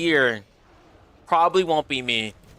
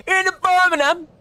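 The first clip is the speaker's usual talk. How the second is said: high, hammed-up Birmingham accent; badly faked Brummie, pitched way up; mock Birmingham accent; 14 semitones higher, 4 dB louder than usual. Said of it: "I don't know the accents".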